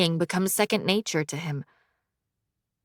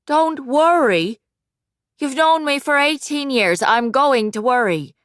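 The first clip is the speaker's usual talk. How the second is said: high, slow motherly insistence; worried mother; 6 semitones higher, 5 dB louder than usual. Acted. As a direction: plead like a fond mom.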